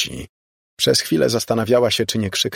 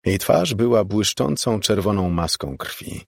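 ordinary delivery, nothing marked

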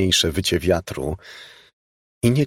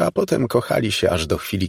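plain reading voice

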